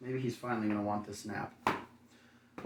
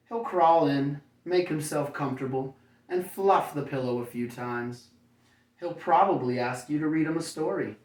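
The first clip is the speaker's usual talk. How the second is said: bright, lively storybook read, higher and louder; reading to children; 4 semitones higher, 5 dB louder than usual. A narrator's reading. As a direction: read it like bedtime story to little kids. Reads picture book to children.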